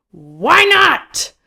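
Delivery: high, loud yell; piercing shouted demand; shout over music. A narrator's reading. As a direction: hurl the question across a din. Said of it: "I shout again over the music".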